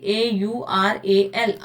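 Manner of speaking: एयूआरएएल spelled letter by letter, very slowly. Shown as a demonstration. Teacher spells the word slowly for the students. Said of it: "वो तो हमारी क्या थी?".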